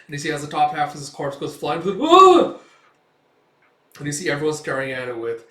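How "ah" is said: extremely high, loud shriek; horrified scream. Acted. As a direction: shriek at the flying corpse. Onlooker screams at bisection.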